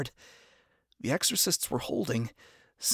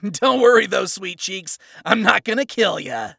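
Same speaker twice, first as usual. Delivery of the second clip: loud, higher, taunting sneer; cocky villain; 6 semitones higher, 10 dB louder than usual. Acted. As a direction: gloat over the fallen. Villain taunts after laughing.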